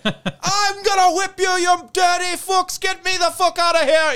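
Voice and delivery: high, loud furious shouting; yelling from the coffin. Retold or imitated. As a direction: scream to be let out of the coffin. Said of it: "And he's like".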